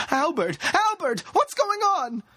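very high, frantic calls; desperate shrieked questioning; panicked screaming. A narrator's reading. Woman panicking as companion is dragged away.

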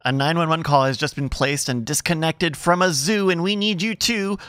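ordinary delivery

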